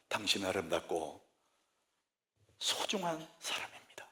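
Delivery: slow, hushed, ending in a whisper; slow whispered blessing. Shown as a demonstration. Preacher blesses the congregation in a slow whisper. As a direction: let each word land softly. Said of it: "우리 입에".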